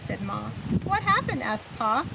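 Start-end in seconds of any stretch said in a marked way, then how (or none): 0.8-1.4 high, startled "what happened"; surprised question. A narrator's reading. Pa startled by news about Wilbur, high surprised. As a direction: ask it with a jolt of alarm.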